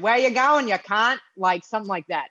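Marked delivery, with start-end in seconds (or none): 0.0-1.2 attempted Australian accent, pitched up. Shown as a demonstration. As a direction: put on a rough-and-ready Australian accent. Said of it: "try to do an Australian accent".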